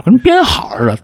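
ordinary delivery

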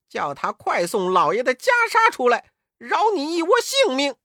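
loud, high indignant tirade; angry scolding. A narrator's reading. Monkey King berates the little gatekeeper demon, loud and scornful. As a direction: scold contemptuously, high and forceful. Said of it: "悟空骂道".

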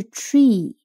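tree with a long drawn-out ee, slowly; wrong long vowel. Shown as a demonstration. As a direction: stretch the ee in tree. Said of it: "不是tree的长音". (长音tree的错误读法)

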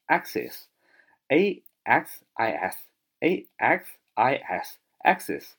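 axis slow with AX stressed; stress on first syllable. Shown as a demonstration. Teacher drilling vocabulary for class, first-syllable stress. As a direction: hit the AX in axis. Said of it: "重音在最前面".

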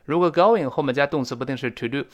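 usual speaking voice

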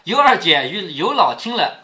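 ordinary delivery

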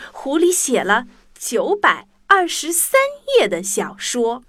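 plain reading voice

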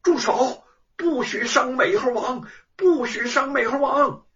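frantic shout of stop; urgent shout. A narrator's reading. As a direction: yell to save the monkey. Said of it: "忙叫".

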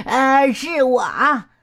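slow words through a big grin and laugh; cheeky reveal, laughing; monkey laughing at his own prank. A narrator's reading. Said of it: "猴子咧嘴大笑".

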